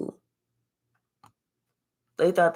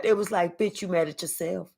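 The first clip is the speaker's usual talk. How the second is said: normal talking voice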